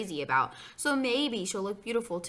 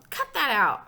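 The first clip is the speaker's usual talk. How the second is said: loud, higher snap; irritated kid's raised voice; annoyed protest; 5 semitones higher, 7 dB louder than usual. A narrator's reading. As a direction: tell a pest to stop. Boy objects to being taunted.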